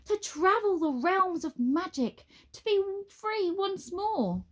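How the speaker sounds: high, grand wizard voice, slowing at the end; rapturous wizard. A narrator's reading. Wizard to Nine, dreamily grand. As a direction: swoon over the thought of travel.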